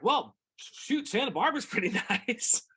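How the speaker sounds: light laugh breaking into a retold thought. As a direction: chuckle as you say it. Laughing retelling.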